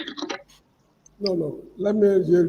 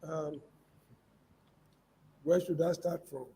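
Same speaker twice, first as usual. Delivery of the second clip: barely audible; 6 dB quieter than usual. Real, not acted. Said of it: "Your voice is very low".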